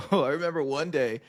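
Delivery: ordinary talk, nothing marked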